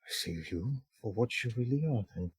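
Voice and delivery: very soft, hushed acted disappointment; hurt realization. Young drow sees two rivals, quietly disillusioned. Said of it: "looks between the two and goes like".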